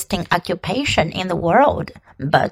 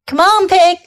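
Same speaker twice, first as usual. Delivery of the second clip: calling out; 8 semitones higher, 3 dB louder than usual. Acted. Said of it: "said Lovey".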